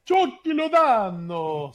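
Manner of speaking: dramatic rolled Spanish announcer style, slow; fake Spanish accent. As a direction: stretch it out like a Spanish commentator. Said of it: "especially in a fake Italian accent".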